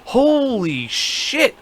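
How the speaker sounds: very slow, high-pitched astonishment; stunned amazement. Retold or imitated. Teller replaying the reaction alone in the car, stunned amazement. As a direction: draw each word right out, high and floored.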